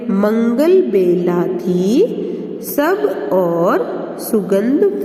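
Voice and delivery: reads each word slowly and clearly, drawing out the nasal sounds; a teacher reading a simple passage aloud to young pupils; slow reading for children. Acted. Teacher reading the anusvara passage aloud to her class, slow and clear.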